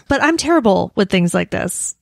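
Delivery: normal talking voice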